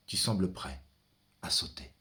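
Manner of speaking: soft unvoiced whisper. Poet watching a man about to jump, hushed whisper. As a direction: breathe it out in fear.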